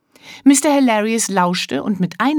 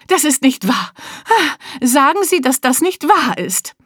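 pleading disbelief; 7 semitones higher than usual. Acted. Mister Hilarious hearing bad news.

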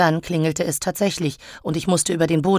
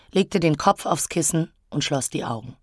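ordinary delivery, nothing marked

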